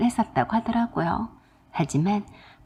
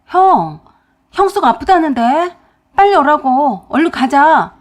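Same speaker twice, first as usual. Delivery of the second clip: loud, urgent, higher call down the phone; 5 semitones higher, 7 dB louder than usual. Retold or imitated. Husband urging his brother to come, loud and urgent. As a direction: hurry him over the phone.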